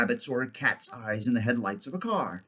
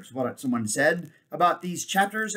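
ordinary delivery

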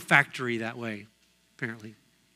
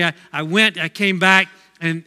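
ordinary talk

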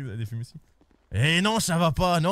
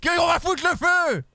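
angry shouting; 7 semitones higher than usual. Acted. Protester yelling at police.

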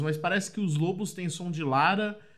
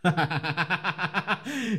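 real staccato nervous laugh; nervous laughter. Real, not acted. Gamer facing the unknown level, laughing nervously. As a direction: laugh out of sheer nerves. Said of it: "Eu tô rindo de nervoso".

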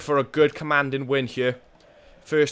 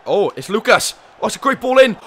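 much higher, louder excited call; rising excitement; 8 semitones higher, 10 dB louder than usual. Real, not acted. Manager urging a late equaliser, rising excitement. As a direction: climb with the chance.

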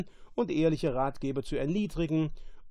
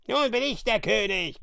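high, louder croaking creature; 8 semitones higher, 5 dB louder than usual. Acted. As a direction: croak it out like a brute. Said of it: "krächzte Caliban".

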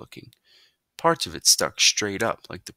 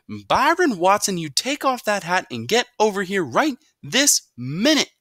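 furious full-name call; 7 dB louder than usual. A narrator's reading. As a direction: lay down the law with every word. Mother uses full name.

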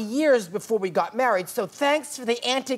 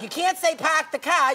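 put-on Boston accent; 7 semitones higher than usual. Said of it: "They're like".

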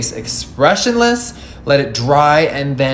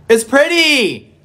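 excited; 13 semitones higher, 6 dB louder, slower than usual. Real, not acted. Delighted by finished makeup.

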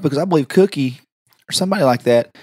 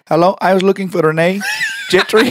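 higher, put-on prank caller; 5 semitones higher than usual. Asking the receptionist for Renee, prank caller voice. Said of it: "Why can you talk like, exactly like that".

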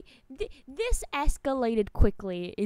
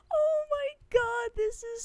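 shocked squeal; 13 semitones higher than usual. Real, not acted.

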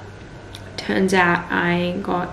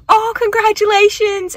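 imitating a delighted stranger, much higher and louder; cheery bystander; 13 semitones higher, 5 dB louder than usual. Passengers hearing she is pregnant, high delighted congratulations. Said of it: "They were like".

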